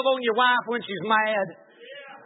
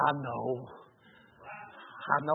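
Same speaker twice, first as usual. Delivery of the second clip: gentle voice of God; 10 semitones lower, 11 dB quieter than usual. Retold or imitated.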